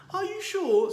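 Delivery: fretting that the bear will ruin a watch; anxious. A narrator's reading. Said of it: "said Missus Brown anxiously".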